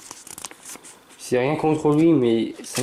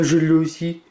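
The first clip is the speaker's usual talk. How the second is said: normal talking voice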